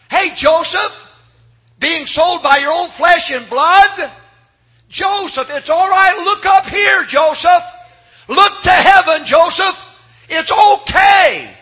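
high, loud ringing call to Joseph; preaching shout. Acted. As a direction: call out to him from heaven at full force. Preacher calls Joseph as God.

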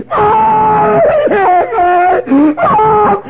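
high drawn-out mock wail; crying little Susie. Susie back again to tell on Billy, hammed-up crying. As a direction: bawl like a hurt child telling on someone. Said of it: "she comes in there and she's crying".

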